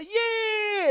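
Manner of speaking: long, high mock yell; imitated shouting out. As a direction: re-enact a drawn-out yelp of pain. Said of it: "So I'm shouting out".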